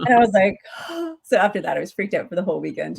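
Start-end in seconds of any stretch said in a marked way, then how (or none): 0.6-1.2 mock gasp. Realizing she touched doll.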